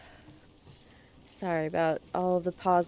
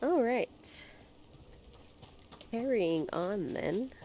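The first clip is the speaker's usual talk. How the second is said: hoarse, stuffed-up voice; hoarse nasal voice; 12 dB quieter than usual. Real, not acted. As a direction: talk through a hoarse, nasal throat. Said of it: "hoarse, nasally voice".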